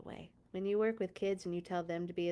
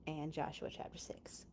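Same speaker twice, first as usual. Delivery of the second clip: soft whisper tossed off; whispered aside; 5 semitones lower, 12 dB quieter than usual. Real, not acted. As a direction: murmur it like a side note. Aside about the grown-up Bible.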